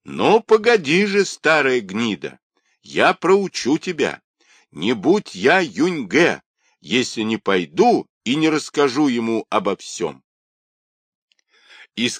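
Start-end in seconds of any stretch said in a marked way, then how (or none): 0.0-10.2 put-on high, emphatic boyish threat; shouted threat. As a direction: call the warning back at the old woman, pitch raised. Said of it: "крикнул старухе".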